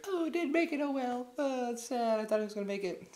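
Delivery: high falsetto lament; put-on girlish regret, very high; mock disappointed girl. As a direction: imitate a girl briefly sighing over her loss. Voicing girl on boat.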